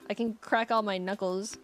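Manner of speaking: ordinary delivery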